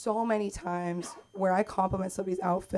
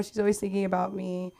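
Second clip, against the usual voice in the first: usual speaking voice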